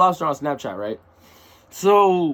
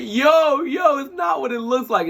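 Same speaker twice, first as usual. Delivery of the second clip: panicked denial; 10 semitones higher than usual. Retold or imitated. To the coach, caught off guard, a panicked laughing protest. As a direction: blurt it out caught red-handed.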